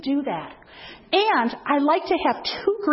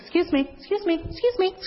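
put-on little old lady; 5 semitones higher than usual. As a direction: squeeze through the crowd politely. Old lady crosses the room.

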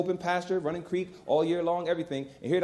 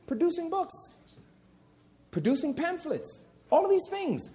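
preacher echoing himself, pitched way up and soft; much higher, softer repeat; 11 semitones higher, 3 dB quieter than usual. Shown as a demonstration. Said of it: "said again higher".

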